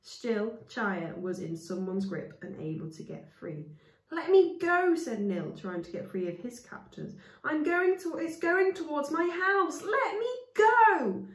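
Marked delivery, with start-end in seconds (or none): 4.1-11.3 desperate shout. Acted. Nil struggling against captors.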